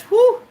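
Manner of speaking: sudden loud high whew, overcome; a real rush of feeling let out in one breath; emotional exhale. Real, not acted. Vlogger overwhelmed talking about gratitude, loud exhale. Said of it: "That's gratitude".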